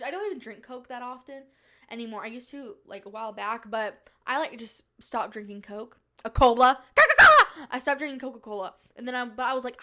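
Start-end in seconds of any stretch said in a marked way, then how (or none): 6.2-7.7 blurting the full brand name with a shout; exclaimed brand name. Acted. Vlogger correcting herself with a loud exclaim.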